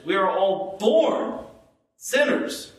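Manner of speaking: "born" lifted in pitch, pause before "sinners"; stress on born. Shown as a demonstration. Preacher showing each word matters, stress on "born". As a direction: punch "born", then hold a beat. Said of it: "Any word we could emphasize there".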